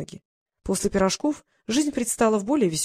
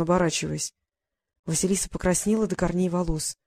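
ordinary delivery, nothing marked

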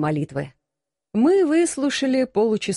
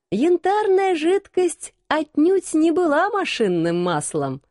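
plain reading voice

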